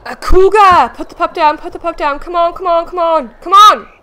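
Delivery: urgent high, loud shouts; real alarm. Real, not acted. Player shouting at a cougar to drop the pup, panicked. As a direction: yell frantically to save a pup. Sudden cougar attack on the pups.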